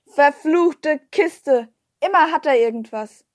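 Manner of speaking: loud, drawn-out curse; angry cursing. A narrator's reading. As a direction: swear in exasperation at a broken machine. Old man cursing his computer.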